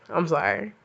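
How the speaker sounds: ordinary talk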